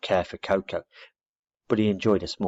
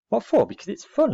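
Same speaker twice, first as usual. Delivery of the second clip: indignant; 14 semitones higher, 5 dB louder than usual. Acted. Scout retorts indignantly.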